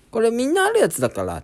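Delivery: a hint of the doctor's voice. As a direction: play the doctor brushing it off. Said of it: "とか言って".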